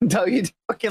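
usual speaking voice

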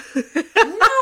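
high loud rising laugh. Real, not acted. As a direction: burst out laughing. Laughs at own remark.

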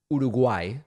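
Uruguay said the Spanish way, oo-doo-GWAI; Uruguay as a native Spanish speaker says it; Spanish pronunciation. Retold or imitated. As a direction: tap the r in Uruguay, ending on GWAI. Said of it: "Spanish pronunciation".

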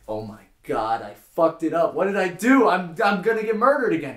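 loud panic climbing high; acted terror. Joke teller as prisoner whose joke met silence, hammed-up panic. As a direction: build from dismay to high, frantic yelling. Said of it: "He's terrified again".

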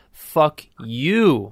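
"you" drawn out, swooping up then falling; stress on YOU. Shown as a demonstration. Comic telling grandma a joke, stress on YOU. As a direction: punch "Fuck you", stretching the YOU. Drawing out the joke's punchline.

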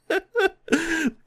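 high-pitched giggling laugh; high laughter. Real, not acted. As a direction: burst out laughing. Laughing at the movie scene.

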